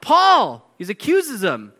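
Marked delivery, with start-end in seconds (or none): 0.0-0.7 high loud blurt of the name. Retold or imitated. Festus interrupting Paul mid-defense, loud blurt. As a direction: snap his name out loud. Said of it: "blurting this out".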